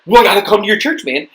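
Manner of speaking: high, loud, fired-up agreement; excited reply. Retold or imitated. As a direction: act out an eager convert to the idea. Atheist's excited reply, acted out.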